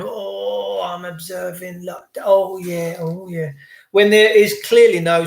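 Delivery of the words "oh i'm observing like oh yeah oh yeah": slow, soft mock-dopey murmur; lightly voiced dozy bystander; passive sheep voice. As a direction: mimic a passive onlooker. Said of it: "passively going".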